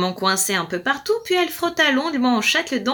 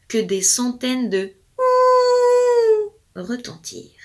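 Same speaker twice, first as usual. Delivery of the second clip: howl-tinged line; slower than usual. A narrator's reading. Many howls.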